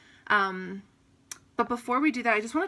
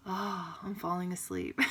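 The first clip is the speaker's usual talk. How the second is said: mock sleepy, softer voice; drowsy murmur; 7 dB quieter than usual. Yoga teacher acting out someone nodding off, drowsy. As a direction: softly act out drifting off.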